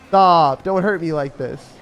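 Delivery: mock plea. Begging opponent during game loss.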